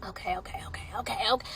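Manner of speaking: breathy whispered okays to herself; whispering. Real, not acted. She whispers to herself before starting the video. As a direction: whisper it, calming yourself down.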